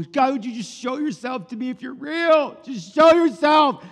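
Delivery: mock pleading demand. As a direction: build to an exasperated, hammed-up shout. Acts out a doubter demanding proof.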